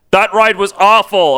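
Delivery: mock-bitter grumble. A narrator's reading. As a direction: sulk it out bitterly. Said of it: "Apocalypse said bitterly".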